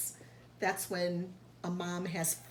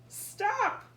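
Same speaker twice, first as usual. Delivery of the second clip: calling out stop. Acted. Friends calling after Q as she walks away, high shout. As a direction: call after her to stop.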